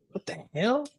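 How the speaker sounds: high, hushed mock outrage; imitating a stunned customer; customer's outburst. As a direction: squeak it like a shocked customer. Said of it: "when the customer goes".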